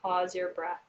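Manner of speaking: slow, calm counting of breaths; guided breathing. Acted. Leads counted breathing exercise.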